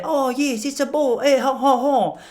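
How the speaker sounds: high mock French child; hammed-up French accent with a cartoon laugh; French kid. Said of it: "talking to a little French kid".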